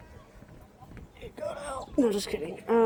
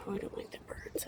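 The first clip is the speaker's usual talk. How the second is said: whispered dislike; 14 dB quieter, faster than usual. Real, not acted. Passing the bird net.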